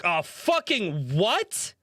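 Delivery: slow, high, drawn-out mock dismay; retold dismayed disbelief. Server hearing of a thirty-two top, retold dismay. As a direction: stretch it out in acted disbelief.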